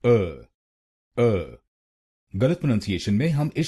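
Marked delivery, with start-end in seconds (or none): 0.0-1.6 अ said alone, a short clear a; syllable demo. Shown as a demonstration. Teacher drills the second syllable. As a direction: sound अ on its own. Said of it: "दूसरे शब्दांश को बोलिए".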